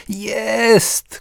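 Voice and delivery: high, loud goal cheer; father yelling after scoring on his son; triumphant shout. A narrator's reading. Father cheering his goal at his son, high loud shout. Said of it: "krzyknął Karol Wojtyła senior".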